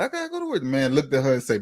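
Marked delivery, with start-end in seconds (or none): 0.0-0.6 mock crying whine.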